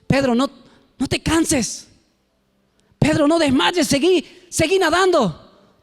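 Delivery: building from mild to high, loud encouragement; urging on. Acted. Jesus urges the swimming Pedro on, building to a loud high cheer. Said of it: "Jesús lo único que estaba diciendo".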